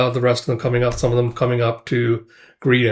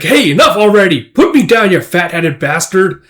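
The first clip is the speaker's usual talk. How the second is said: loud, raised-pitch indignant protest; angry shouting; 6 semitones higher, 7 dB louder than usual. Retold or imitated. Guts yells at the big man carrying him, angry shouting. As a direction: holler to be let go.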